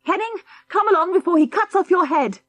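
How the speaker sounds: high, loud panicked yell; a man flees a sword-swinging old man, shouting; alarmed shout. Acted. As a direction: shout the warning while fleeing. Durlston still fleeing, shouting.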